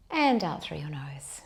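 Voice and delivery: calm them into the breath; guided meditation. Acted.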